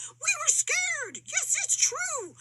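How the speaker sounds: high, slow, quavering admission; mock scared. A narrator's reading. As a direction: confess the fright slowly. Monkeys admit fear.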